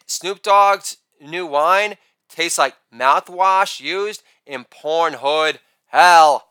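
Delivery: normal talking voice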